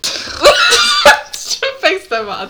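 high squeaky laughing voice. Real, not acted. Lacht hoch beim Sprechen. (quietschend lachend)

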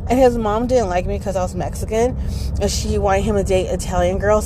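ordinary delivery

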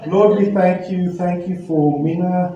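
ordinary delivery

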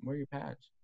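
high, soft coach voice; lightly voiced coach. Coach asking where pads are.

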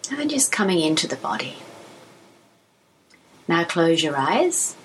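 calm, even voice with long pauses; unhurried phrases, each left to settle; guided meditation. Acted. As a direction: guide them gently through body and breath.

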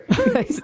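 sudden high laugh; a real laugh; high burst of laughter. Real, not acted. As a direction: crack up out loud. Sorry joke lands.

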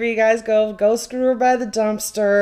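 high, loud sarcastic tell-off; mock scolding. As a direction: scold them with mock cheer. Telling couple off.

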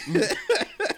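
high, loud burst of laughter. Real, not acted. Host laughing at his own youthful priorities, high cackle. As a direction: crack up at your own joke.

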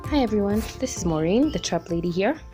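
usual speaking voice

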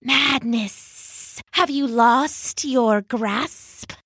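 slow, hissing snake voice; slow hissed snake outrage; hissing adder. Acted. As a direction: hiss it out slowly like a snake. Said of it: "hissed the adder".

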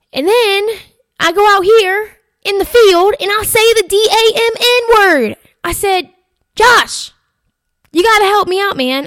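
high, loud, worked-up retelling; exasperated plea. Retold or imitated. Begging the videographer for help, loud, high exasperation. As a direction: raise voice and pitch in mock desperation.

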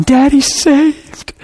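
high, drawn-out tearful cry; imitated tearful joy. Daughter on the phone about saved daddy, imitated crying. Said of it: "And she's crying".

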